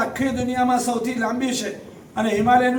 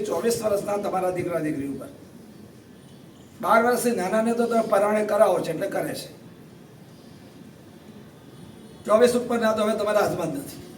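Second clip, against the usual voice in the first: quiet heartfelt sermon passage; sincere soft appeal; 4 semitones lower, 5 dB quieter than usual. Real, not acted. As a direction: lower and softer, earnest counsel to parents. Soft earnest preaching.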